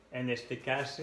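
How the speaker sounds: normal talking voice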